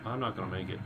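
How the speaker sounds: ordinary delivery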